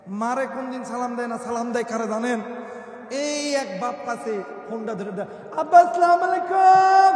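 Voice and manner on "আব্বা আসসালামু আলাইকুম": high, loud, drawn-out mock salaam; showy phone greeting. Son greets father-in-law on the phone, exaggerated long salaam. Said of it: "কি সালামের style!".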